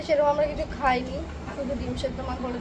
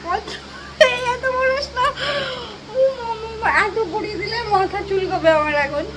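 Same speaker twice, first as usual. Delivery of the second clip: 1 whiny crying complaint; 7 semitones higher, 5 dB louder than usual. Real, not acted. Complaining during bath, crying out.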